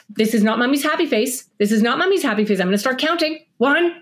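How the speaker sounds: a hint of a mom losing patience; mock stern mom. Mom counting down at her child in the bath. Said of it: "what do we do when a four-year-old".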